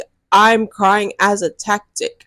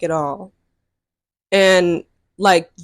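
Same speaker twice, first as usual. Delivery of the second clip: usual speaking voice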